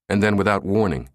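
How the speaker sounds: plain reading voice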